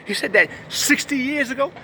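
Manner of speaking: high voice, rising on ago in disbelief; shocked disbelief. Retold or imitated. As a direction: ask it like you can't believe it. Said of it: "You'd be shocked, like".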